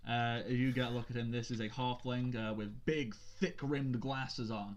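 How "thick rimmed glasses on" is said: ordinary talk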